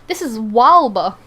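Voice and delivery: loud, sure-of-yourself declaration; cocky know-it-all proclamation; very confident. Acted. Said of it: "very confidently saying one thing".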